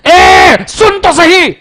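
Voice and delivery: loud, high, slow shouted demand; angry shout. Shown as a demonstration. Teacher acting an angry shout to show an offer in any emotion. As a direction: yell it like you've lost patience. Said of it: "हर emotion में offer ही है".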